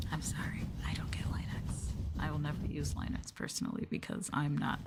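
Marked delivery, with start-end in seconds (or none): 0.1-1.9 whisper. Real, not acted. Streamer whispering an apology to sleepy viewers. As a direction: whisper it close to the mic. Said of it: "ASMR stream whispering".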